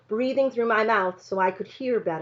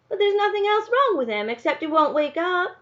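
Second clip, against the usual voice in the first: questioning; 10 semitones higher than usual. A narrator's reading. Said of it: "sounded more like a question".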